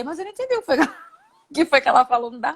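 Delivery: laughing while confessing; words breaking into laughter; giggling admission she understood nothing; laughing through it. Real, not acted. Laughing at own confusion.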